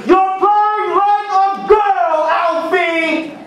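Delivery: mocking coach yell; hollering coach. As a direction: holler it across the court like a bully coach.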